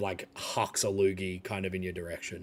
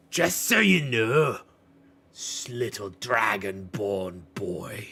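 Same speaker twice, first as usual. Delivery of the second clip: Ralmar cutting through; 4 dB louder, slower than usual. Acted. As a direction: talk down to an upstart boy, slowly. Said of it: "Ralmar kind of cuts through and goes".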